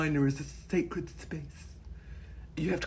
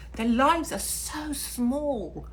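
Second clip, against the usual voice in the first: scornful; 9 semitones higher, much slower than usual. Real, not acted. Scornful slow remark.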